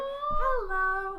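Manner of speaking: high, loud toy voice; squeaky toy robot; Furby voice. Retold or imitated. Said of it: "it'd say, like".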